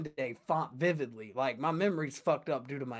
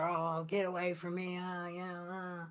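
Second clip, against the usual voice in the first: brothers scuffling; 4 semitones higher, 4 dB quieter than usual. Retold or imitated. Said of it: "used to fight".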